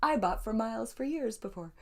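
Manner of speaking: high, excited fangirl gush; starstruck excitement. Retold or imitated. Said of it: "it is for me to be like".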